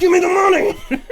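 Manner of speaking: high, loud mock demand as a little girl; demanding toddler. Dad imagines his daughter collecting money, high mock demand. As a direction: play a tiny enforcer shaking down the guests. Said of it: "she would come up to you and be like".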